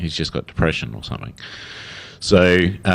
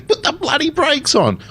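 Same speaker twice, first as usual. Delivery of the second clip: much higher, far-off alarmed cry of a driver; mock panicked yell. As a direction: yell it like someone whose car won't stop. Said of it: "everyone goes".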